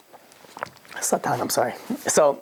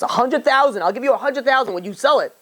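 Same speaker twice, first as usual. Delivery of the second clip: pitched up high, pressing the offer; eager raised offer; 8 semitones higher than usual. Retold or imitated. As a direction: push the bid up eagerly, voice high. Collector raises his offer to boy.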